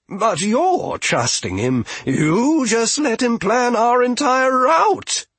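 high, loud whiny protest; Toby's high voice. A narrator's reading. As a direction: pitch it up like an indignant kid. Toby protesting.

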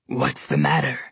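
low, sly bear voice; mocking bear. A narrator's reading. Polar bear taunting Goldilocks, low and sly. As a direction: toy with the prey. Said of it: "the polar bear asked her".

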